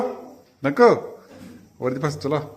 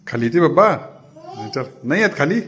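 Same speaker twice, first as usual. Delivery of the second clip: put-on high, coaxing baby talk to a cat; pet talk; 8 semitones higher, 6 dB louder than usual. Owner coaxing the cat Mau, high baby talk. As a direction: coo at the kitten, raised voice.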